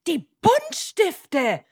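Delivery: high, loud, drawn-out answer; calling out. Acted. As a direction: call it out like an excited schoolboy. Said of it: "Leo ruft". (hoch und laut gerufen)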